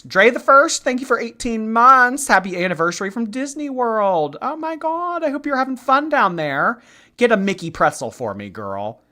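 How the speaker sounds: high, loud, gushing shout-out; excited gushing. Real, not acted. Streamer thanking a subscriber who is at Disney World, excited gushing. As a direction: thank them with squealing excitement.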